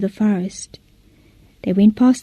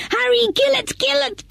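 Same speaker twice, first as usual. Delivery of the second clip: much higher frantic cry; urgent call; 13 semitones higher, 5 dB quieter than usual. Acted. Villagers seeing the snake come out, frantic. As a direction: hurry them to strike. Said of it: "called the people".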